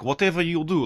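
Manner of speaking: loud, high mock Russian accent; Russian instructor. Imitating his Russian flight instructor in the sky, loud mock accent. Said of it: "and he's like".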